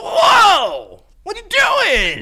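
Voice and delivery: high startled whoa; dog owner reaction. Retold or imitated. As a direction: shout it at the dog. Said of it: "biggest dog owner reaction".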